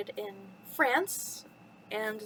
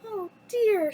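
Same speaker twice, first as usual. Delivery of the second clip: high, louder storybook dismay; acted cry of disappointment; Belle crying out; 9 semitones higher, 6 dB louder than usual. A narrator's reading. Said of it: "she cried".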